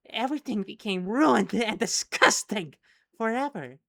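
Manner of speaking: loud, high, unhinged ranting. Acted. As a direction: rant it like a storyteller losing it. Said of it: "you could hear how unhinged we managed to get".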